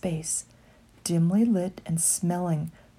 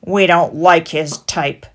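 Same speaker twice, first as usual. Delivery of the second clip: louder, snooty rat; sniffy disdain; 9 dB louder than usual. A narrator's reading. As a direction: look down your nose. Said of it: "sniffed the chief".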